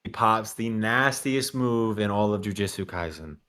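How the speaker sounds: normal talking voice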